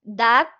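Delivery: "Dad" isolated and said loud; single emphatic "Dad" to show the stress; stressed syllable alone. Shown as a demonstration. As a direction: punch "Dad" as one strong syllable. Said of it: "es la sílaba tónica".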